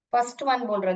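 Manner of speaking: ordinary delivery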